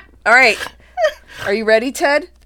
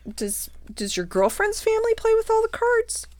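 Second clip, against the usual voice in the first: incredulous question; 13 semitones higher than usual. Retold or imitated. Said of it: "And I'm like".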